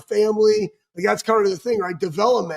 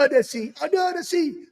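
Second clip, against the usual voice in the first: put-on sung high in a Jamaican accent, like the crab; Sebastian's Jamaican accent; 7 semitones higher than usual. A friend mimicking a player's Jamaican-accented crab song. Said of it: "A Jamaican accent".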